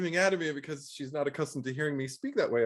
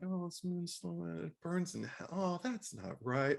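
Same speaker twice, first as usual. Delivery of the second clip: mock sad murmur; 4 semitones higher, 9 dB quieter than usual.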